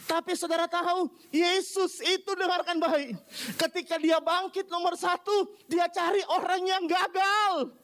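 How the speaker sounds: high-pitched, insistent sermon delivery; impassioned preaching. Real, not acted. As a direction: drive the point home from the pulpit. (khotbah berapi-api)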